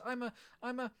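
high, loud stammered start; flustered faltering. Acted. Alice struggles to say what she is, flustered.

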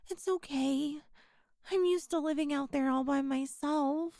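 ordinary talk

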